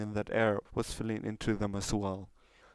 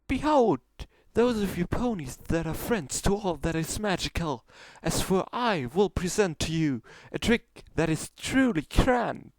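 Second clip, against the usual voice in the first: much higher, louder boastful stage voice; grand showmare announcement; 9 semitones higher, 4 dB louder than usual. Acted. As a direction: proclaim like a vain stage magician. Trixie announces final trick.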